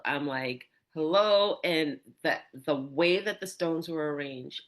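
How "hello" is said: louder call of hello into the desert; louder hello, a step short of her full shout; retold yell. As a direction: call out hello, lost and searching. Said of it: "And so then I yelled".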